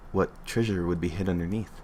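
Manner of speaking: ordinary delivery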